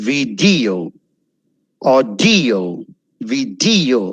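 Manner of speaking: "vi-DEE-o", "aw-DEE-o" for Video, Audio; second-syllable stress. Shown as a demonstration. Teacher drilling Audio and Video, second-syllable emphasis. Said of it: "ആ second സിലബിളിൽ".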